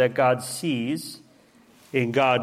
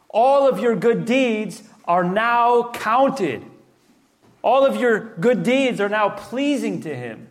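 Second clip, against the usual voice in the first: full-force preaching; 5 semitones higher than usual. Acted. Pastor urging the congregation, full-force preaching. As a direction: preach it out, building fervour.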